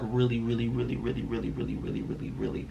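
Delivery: playful fade-out to a breathy whisper; fading to a whisper. Real, not acted. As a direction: let the repeated words die away to a whisper. Trailing repeated really.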